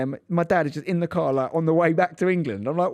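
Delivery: ordinary talk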